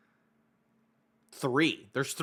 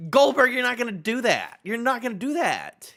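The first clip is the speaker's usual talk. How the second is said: normal talking voice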